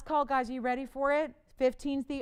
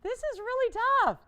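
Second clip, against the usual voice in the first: laughing exasperation; 10 semitones higher, 6 dB louder than usual. Real, not acted. Bidding stalls, laughs.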